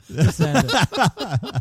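laughing. Real, not acted. Repeats phrase, laughing, drawn out.

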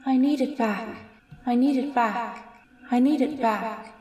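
high, hollow, mournful ghostly plea; forlorn ghost woman. Acted. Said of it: "just once more".

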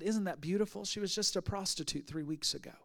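leaning in, very soft and low; whispered aside. Retold or imitated. Pastor friend leaning over in church, hushed whisper. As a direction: murmur it close to a friend's ear.

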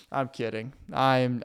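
usual speaking voice

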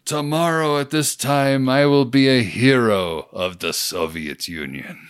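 mock Russian general accent; a light Russian accent, proud. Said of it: "in a much better accent".

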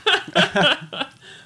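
high burst of laughter; real laugh at the joke. Real, not acted. Laughing at the impression.

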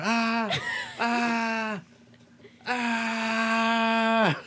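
long, high-pitched mock screams; sustained high wail, imitating a man screaming; imitated screaming. Retelling the patient dragged down the hallway, imitated screaming. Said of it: "I just start hearing screaming".

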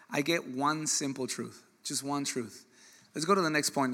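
ordinary talk

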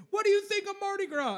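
high, chirpy mock reporter voice; news reporter. Reporter stopping a paradegoer for comment, high mock reporter voice. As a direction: pitch it way up like an eager interviewer. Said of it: "these news people".

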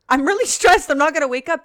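retelling oversleeping, mock panic. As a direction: act your past self freaking out. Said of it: "I'm like".

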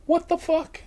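high, disbelieving what the fuck; shocked. Real, not acted. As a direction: blurt it out in shock. Shocked by the ashes.

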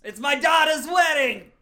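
indignant shout. Acted. Father to wedding coordinator, indignant drawn-out shout. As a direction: holler it as the outraged father. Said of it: "they were just being loud during the ceremony".

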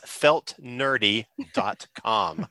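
slow, careful, clearly spelled out. Shown as a demonstration. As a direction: spell it out slowly for the audience. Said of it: "in case that's not clear to the audience".

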